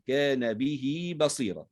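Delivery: slow recitation, "به" stretched to bihii; ha joined with ya. Shown as a demonstration. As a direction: draw the i of "به" into a long ya. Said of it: "فنصلها بياء".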